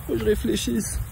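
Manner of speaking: high put-on voice of a doubter; mock hesitant voice. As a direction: play the unsure client. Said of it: "À partir du moment où tu te dis".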